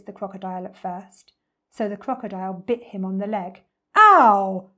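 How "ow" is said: loud yelp from a big elephant; booming cry of pain; big deep voice. A narrator's reading. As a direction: bellow it like a hurt elephant. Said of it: "said Trunky in his big, deep voice".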